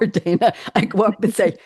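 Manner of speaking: ordinary talk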